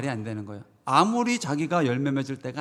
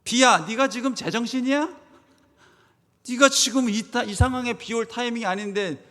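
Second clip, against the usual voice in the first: high-pitched indignant scolding, building louder; acted outrage, rising toward a shout; angry rebuke; 7 semitones higher, 4 dB louder than usual. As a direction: tell off the wind and rain. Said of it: "막 화가 나야 돼요".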